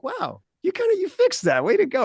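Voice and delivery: high-pitched imitation of an admiring wife; appreciative wife. Wife praising husband for fixing the furnace, appreciative. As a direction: gush in a mock high wife voice. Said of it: "my wife is very appreciative".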